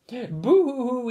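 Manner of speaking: high, slow hammed-up boo-hoo sobbing; mock baby crying. A narrator's reading. Big baby crying to his adoring parents, hammed-up sob. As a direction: wail it like an overgrown baby. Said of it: "he cried".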